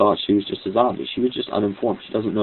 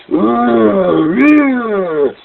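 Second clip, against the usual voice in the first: high, loud mock growl; imitated growl; 12 semitones higher, 6 dB louder than usual. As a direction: growl like an angry animal. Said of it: "she's really growling".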